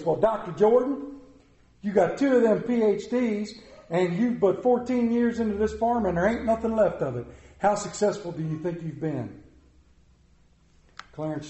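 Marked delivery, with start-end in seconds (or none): 0.0-9.4 high, loud, taunting reporter; haughty reporter. A narrator's reading. Newspaper reporter taunting Clarence over his burned farm, haughty. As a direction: look down on him with each word. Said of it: "said in a haughty voice".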